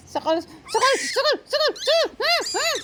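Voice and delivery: rising giggly sakal; laughing mock threat. Real, not acted. Owner laughing while mock-threatening the kitten. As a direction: tease with a laughing squeal.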